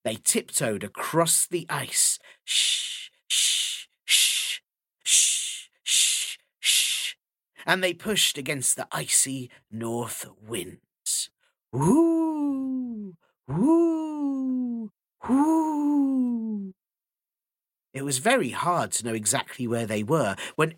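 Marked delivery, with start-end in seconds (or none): 2.5-7.2 hushing shh. A narrator's reading. Tiptoeing across the ice. 11.7-16.7 high, loud wind howl. A narrator's reading. Icy north winds blowing.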